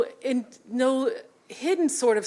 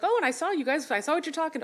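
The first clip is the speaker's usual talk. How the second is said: lightly voiced mom, a bit higher; a hint of a chatty mom; 5 semitones higher, faster than usual. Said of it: "I'm doing my mom voice here".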